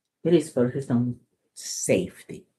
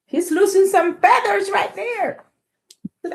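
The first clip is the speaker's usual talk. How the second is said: high, loud amazed; excited; 6 dB louder than usual. Real, not acted. Teacher pointing out the pigeon's tantrum.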